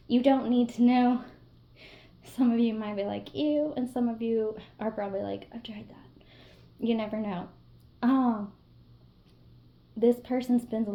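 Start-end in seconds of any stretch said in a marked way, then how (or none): 3.3-3.7 higher, louder mock "ew"; mock disgust. Imagined viewer's disgusted reaction. 5.5-6.2 hushed imitation of a viewer admitting it; whispered confession. Imagined viewer's secret confession.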